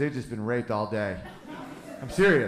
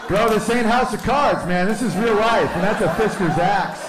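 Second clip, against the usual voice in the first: shouting; 8 semitones higher, 6 dB louder than usual. Retold or imitated. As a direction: bark it at the rooster like a hard man. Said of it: "I'm like".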